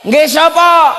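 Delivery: Nggih sapa shouted high. Real, not acted. Preacher pressing the crowd with a shouted question. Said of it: "repeated, louder".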